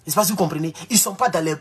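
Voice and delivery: ordinary talk, nothing marked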